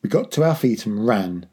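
ordinary delivery

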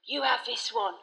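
ordinary delivery

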